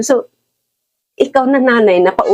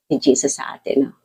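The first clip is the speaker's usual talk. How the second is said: normal talking voice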